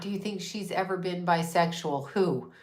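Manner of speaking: normal talking voice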